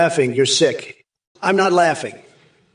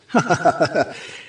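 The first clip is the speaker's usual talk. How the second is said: freakish laugh; 2 semitones higher than usual. Real, not acted. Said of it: "You're laughing like a sick freak".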